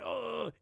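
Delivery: exasperated groan. Retold or imitated. Riled up after talking to them, exasperated groan.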